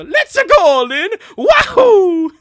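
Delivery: very high, loud whoop; hammed-up game-character hurrah; acted cheering. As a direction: cheer at full volume, voice shooting up.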